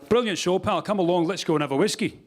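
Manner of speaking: imitating a friendly Scottish punter; Scottish accent. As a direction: cheer the act on like a Scot. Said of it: "in a Scottish accent".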